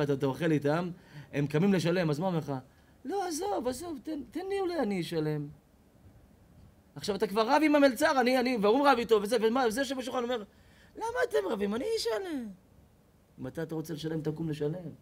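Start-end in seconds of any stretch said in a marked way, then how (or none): 3.0-5.5 acted diner offering to pay. 11.0-12.5 high-pitched, showy generous diner; hammed-up high voice, mock generosity; high mock voice of the guest. Acted guest offering to pay.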